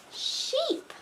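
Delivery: high, unvoiced whispery baa; playful bleat; sheep bleat.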